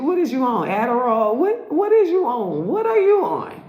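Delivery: high, incredulous squeal of a question; mock disbelief. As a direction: act stunned at what he's on.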